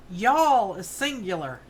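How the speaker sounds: ordinary delivery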